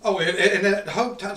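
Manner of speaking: ordinary talk, nothing marked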